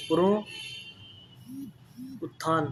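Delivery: पुनरुत्थान drawn out very slowly and softly; slow spelling out. Shown as a demonstration. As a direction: stretch पुनरुत्थान out, thinking aloud. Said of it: "पुनरुत्थान बताना है आपको".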